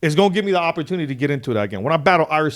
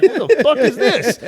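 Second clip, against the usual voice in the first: stunned laughing disbelief; 9 semitones higher, 4 dB louder than usual. Retold or imitated. Stranger walking toward him.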